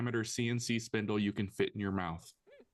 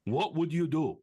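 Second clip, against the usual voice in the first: hammed-up French accent, higher and louder; badly faked French accent, pushed up high; heavy French accent; 5 semitones higher, 5 dB louder than usual. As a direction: lay on a mock French accent thick. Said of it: "Heavy French accent".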